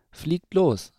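lightly voiced mother owl sending them off; urging mother owl. A narrator's reading. Mother owl urges owlets to fly.

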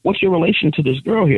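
high prying voice. Retold or imitated. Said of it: "They're like".

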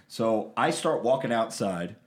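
ordinary talk, nothing marked

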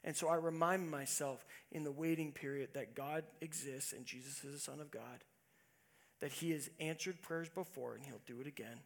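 usual speaking voice

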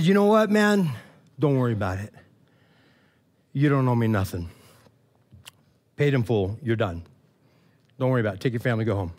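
low, soft and tender release of the debt; a quiet, kindly king letting him go; gentle forgiving king. Retold or imitated. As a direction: speak low and softly as a merciful king.

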